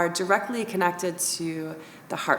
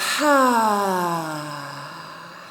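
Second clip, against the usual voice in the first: open-mouth sigh; 2 semitones lower than usual. Shown as a demonstration. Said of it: "Open mouth".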